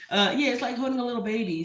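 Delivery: fast, laughing through the words. Real, not acted. Repeating the line to viewers, laughing. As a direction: giggle it out quickly.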